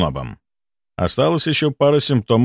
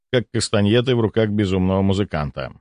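usual speaking voice

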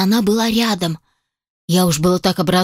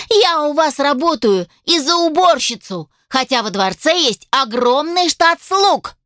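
put-on higher, indignant young woman; angry elf girl; 6 semitones higher than usual. Elf assistant scolds the dragon inventor, higher indignant voice. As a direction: scold him with hurt pride.

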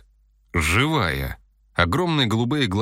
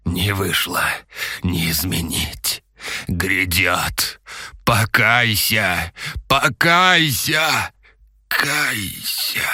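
wheezing, building to a wail; dying cultist rasp; 3 dB louder, much slower than usual. A narrator's reading. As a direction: gasp it out, then cry louder. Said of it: "харкая кровью".

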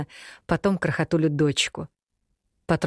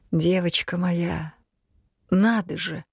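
usual speaking voice

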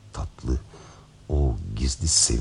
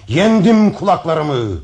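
much higher, loud, slow victory shout; rising triumphant shout; 7 dB louder, slower than usual. Acted. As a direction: build it to a peak of triumph. Repeats louder, higher.

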